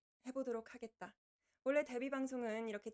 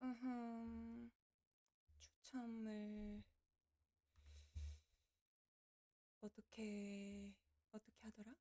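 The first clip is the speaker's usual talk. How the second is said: muttering to self; 8 dB quieter, slower than usual. Real, not acted. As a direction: think aloud quietly while fiddling. Muttering while setting up draw. (혼잣말로 작게 중얼거리며)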